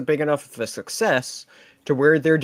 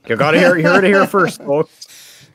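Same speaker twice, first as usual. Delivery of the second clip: loud hyped announcer; 7 semitones higher, 9 dB louder than usual. Pretend. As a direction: pitch it like breaking news. Hyping a scoop to the audience.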